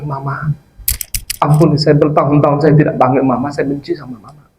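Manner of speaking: ordinary delivery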